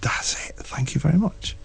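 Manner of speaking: hushed, soft aside; quietly pleased whisper. Retold or imitated. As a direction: whisper it under the breath. Retelling his whispered reply.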